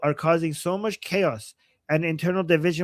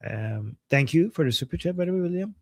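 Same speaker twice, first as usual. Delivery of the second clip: ordinary delivery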